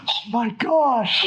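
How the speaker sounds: slow, high, awed; stunned. Real, not acted. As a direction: let the shock sink in. Stunned reaction.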